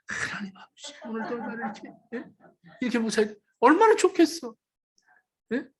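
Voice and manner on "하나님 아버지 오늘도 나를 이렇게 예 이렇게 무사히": a soft, hushed prayer voiced by the preacher; quiet mock prayer. Preacher voicing a husband's prayer after work, soft and hushed. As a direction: pray it quietly like a grateful husband. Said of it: "그게 아니라".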